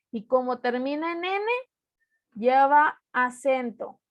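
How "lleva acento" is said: ordinary talk, nothing marked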